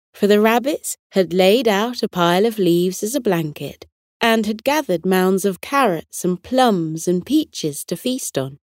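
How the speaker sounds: ordinary delivery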